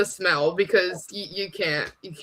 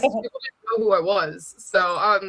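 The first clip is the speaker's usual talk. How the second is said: usual speaking voice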